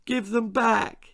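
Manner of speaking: high, slow tearful begging; a bullied boy pleading for his glasses, tearful; tearful plea. Acted. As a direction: beg through the tears. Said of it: "Timothy was crying".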